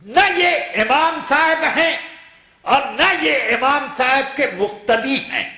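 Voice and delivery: emphatic rhetorical declaration. Acted. Preacher's rhetorical climax.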